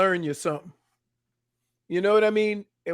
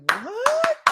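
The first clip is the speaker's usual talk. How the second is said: very high squeaky disbelief; shocked what. Retold or imitated. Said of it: "And I'm like".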